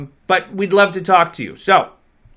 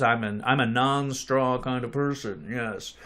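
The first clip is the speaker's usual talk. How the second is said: jaw pushed forward, lower and softer; underbite; 4 semitones lower, 8 dB quieter than usual. Pretend. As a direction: stick the jaw out, goofy put-on accent. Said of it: "You gotta stick your jaw out like that".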